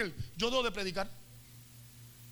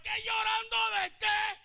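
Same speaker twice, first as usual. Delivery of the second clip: indignant shout; 10 semitones higher, slower than usual. Acted. Mary Magdalene snapping at the angels, indignant shout. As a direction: throw it back at them, offended.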